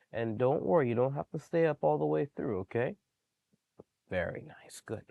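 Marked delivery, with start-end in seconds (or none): 4.1-5.0 soft praise. Real, not acted. Praising the dog quietly.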